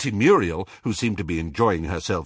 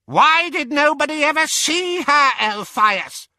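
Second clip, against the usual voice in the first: put-on loud, very high-pitched old auntie squawk; 6 dB louder than usual. A narrator's reading. Auntie Muriel challenging Elphias, squawking. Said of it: "squawked Muriel".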